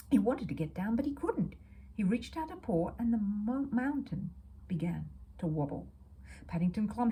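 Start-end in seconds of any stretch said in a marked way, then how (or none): none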